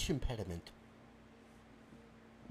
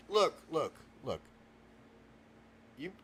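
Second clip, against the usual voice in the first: ordinary talk, nothing marked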